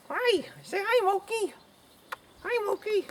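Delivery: playful falsetto for an animal; pet talk. Real, not acted. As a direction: coo hello to a little pet.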